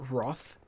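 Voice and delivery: "Roth" with a British rounded aw vowel; British pronunciation. Shown as a demonstration. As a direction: say "Roth" the UK English way, rhyming with cloth. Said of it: "it's actually pronounced Roth".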